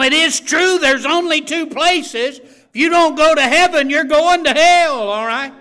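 loud, high preacher's cry; fervent revival-style exhortation; preaching at full force. Real, not acted. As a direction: drive it home to the pews.

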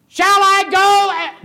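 high, loud and slow call; mock yell. Preacher mocks Miriam yelling her offer, high, loud and slow. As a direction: shout it out far too eagerly.

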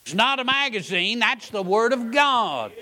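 high, loud "Whoa" then a pointed rebuke; startled exclamation. Retold or imitated. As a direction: call out a careless reader, shocked. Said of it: "I wanna say".

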